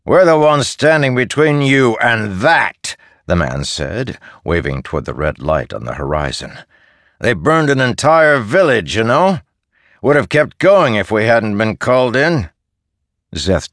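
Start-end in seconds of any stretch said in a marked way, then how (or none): 0.1-2.9 lightly voiced soldier, higher and louder; defensive soldier. Soldier defends himself to the shepherd boy, hinting at defensive anger. 7.2-12.5 aggrieved soldier. Acted. Soldier character voice.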